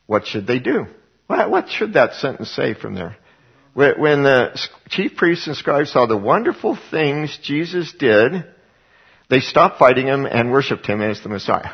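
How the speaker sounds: ordinary delivery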